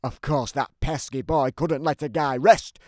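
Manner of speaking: ordinary delivery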